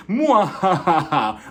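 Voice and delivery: slow theatrical mwahaha; hammed-up evil movie laugh; villain laugh. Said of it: "She sounded like a villain from the movie Batman".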